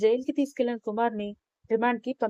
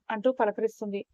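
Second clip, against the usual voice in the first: usual speaking voice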